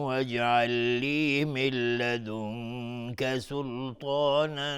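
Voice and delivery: slow, drawn-out chanted recitation; measured Quranic chant. Acted. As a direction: intone the verse slowly and melodically.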